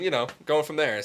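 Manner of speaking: ordinary delivery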